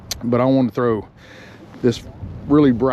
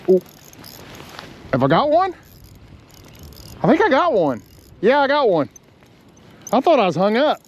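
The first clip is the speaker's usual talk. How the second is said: excited; 12 semitones higher than usual. Real, not acted. Fish strikes unexpectedly.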